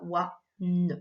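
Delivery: "oie, ne" as two separate sounds; "oie" and "ne" cut apart; split sounds. Shown as a demonstration. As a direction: say "oie", pause, then a separate "ne". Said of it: "il est coupé en deux".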